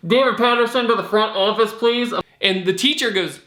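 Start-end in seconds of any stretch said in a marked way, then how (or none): 0.1-2.1 higher-pitched mock intercom voice; intercom announcement. School intercom calls David to the front office, mock announcer voice. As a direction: imitate a school office page over the intercom. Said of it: "The intercom comes on and goes".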